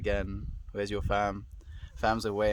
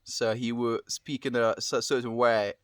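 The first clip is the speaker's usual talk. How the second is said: louder British accent, pitched slightly up; amateur attempt at a British accent; 2 semitones higher, 5 dB louder than usual. Shown as a demonstration. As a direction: put on a British accent on request. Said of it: "can you do a British accent".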